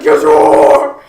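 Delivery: loud insistent mock shout; mock shouting kid. Angry boy insisting friends are dating, loud mock shout. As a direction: yell it like an angry kid who's sure.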